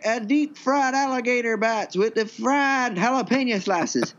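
high-pitched, hammed-up Texas twang; put-on Texas menu pitch; Texas accent. Shown as a demonstration. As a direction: read the menu as an exaggerated Texan. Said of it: "it's just a Texas one".

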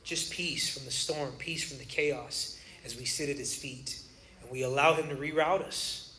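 low, soft, unhurried reassurance; hushed, earnest pastoral comfort; sincere, calm. Real, not acted. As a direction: settle down and speak gently of peace. Sincere turn to peace after comedy.

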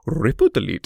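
imitated Indian accent, playfully put on; thick Indian accent. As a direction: say it like an Indian-accented tutorial host. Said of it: "say out loud in his accent".

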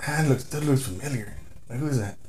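puzzled murmur. Retold or imitated. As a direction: wonder to yourself under your breath. Retold puzzled thought to himself.

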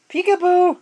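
squeaky high, loud peekaboo. Acted. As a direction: sing it up high for the baby. Playing peekaboo with baby.

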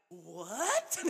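loud incredulous "what"; retold snapping. Woman replaying snapping at boyfriend over cookie remark, outraged. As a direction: let the crazy click burst out. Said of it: "the crazy click. I was like".

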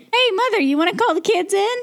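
calling out. Acted. Calling out playfully to mother.

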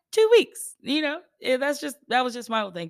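loud, high and fast, brushing it off; excited. Retold or imitated. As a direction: shout it out like it's nothing. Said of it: "I said".

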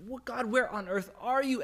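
high-pitched, exasperated plea to God; acted despair. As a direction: imitate a despairing cry, pitched well up. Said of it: "out of despair".

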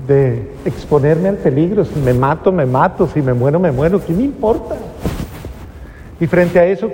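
ordinary talk

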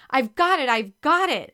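loud, urgent claim of the puck; excited cry. A narrator's reading. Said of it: "Ashley cried excitedly".

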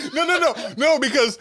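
high, laughing no. Real, not acted. Cohost cutting in on his friend, laughing. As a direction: laugh it out as you deny it.

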